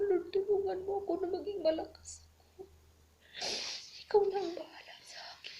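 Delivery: sobbing prayer to God; tearful prayer. Real, not acted. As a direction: beg through real tears. Retold prayer while crying now.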